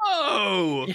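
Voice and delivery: sudden high yell; a startled high yell in the dark; surprised yell. Retold or imitated. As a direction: shout it out in surprise. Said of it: "And you're like".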